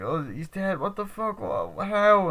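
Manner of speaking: high, stunned acted voice; mock shock. Friend hears he's dead, mock shocked disbelief. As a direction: imitate disbelief rising into alarm. Another friend reacts to the death.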